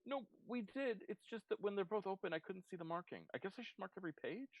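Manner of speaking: high, soft, meek explaining voice; quietly apologetic, high-pitched character; sheepish Blackberry. Acted. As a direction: explain timidly in a small high voice. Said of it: "Blackberry comes to you all and looks very sheepish".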